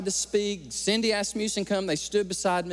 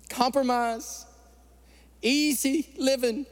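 high, slowed preaching on key words; fervent preaching; 6 semitones higher than usual. Acted. Preacher warning the church that standing firm gets hard, fervent. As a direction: lean into each name and phrase like a preacher.

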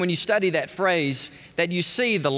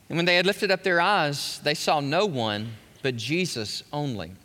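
usual speaking voice

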